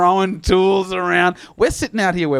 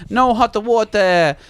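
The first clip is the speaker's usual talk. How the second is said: slow, higher mock Italian accent; 5 semitones higher, slower than usual. Italian plumber reporting the fault to tenant, mock accent. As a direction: stretch it out as a hammed-up Italian plumber. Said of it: "He's Italian".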